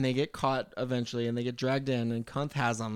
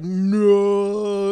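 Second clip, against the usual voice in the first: mock anguished cry; 5 semitones higher, 8 dB louder than usual. Piper reacts to the mullet cut.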